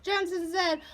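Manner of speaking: usual speaking voice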